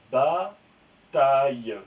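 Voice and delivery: Bataille très lent et fort; mot étiré. Shown as a demonstration. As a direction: allonger Bataille pour la classe. (Bataille lu très lentement et fort)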